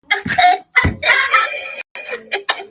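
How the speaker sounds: long, high-pitched peal of laughter; tickled, high laughing fit; real laugh. Real, not acted. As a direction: burst out laughing helplessly. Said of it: "You know why I'm laughing?".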